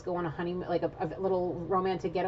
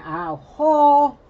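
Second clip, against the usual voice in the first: slow, high, much louder cry; a big drawn-out gasping ho; overwhelmed exclamation; 8 semitones higher, 15 dB louder than usual. Real, not acted. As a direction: let the vision hit you out loud. Overwhelmed exclamation during reading.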